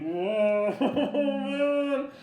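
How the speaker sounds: high laughing whoa; startled and giggling; mock alarmed.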